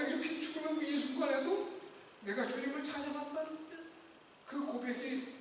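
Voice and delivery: softly, on the edge of tears; a hint of tears while retelling; choked up. Real, not acted. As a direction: let the grief catch in the throat. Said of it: "막 울면서".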